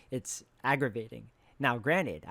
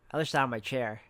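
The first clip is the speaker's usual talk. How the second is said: usual speaking voice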